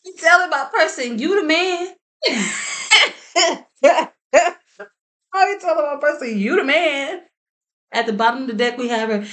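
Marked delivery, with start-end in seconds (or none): 0.1-7.2 amused disbelief. Real, not acted. Reader laughing at the cards telling her person he's the man, high disbelief.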